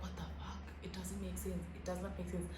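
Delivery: soft, half-whispered bafflement; hushed disbelief. Retold or imitated. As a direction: retell it as a hushed, incredulous protest. Retold disbelieving reply in argument.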